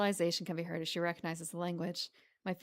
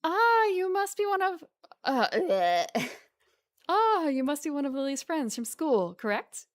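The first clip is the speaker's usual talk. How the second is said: put-on a light, half-hearted Scottish accent on Lily's mother; Lily's mother answering the phone in a weak Scottish accent; attempted Scottish accent; 6 semitones higher, 5 dB louder than usual. Mother greets caller in a faint Scottish accent. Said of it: "I can't do a Scottish accent".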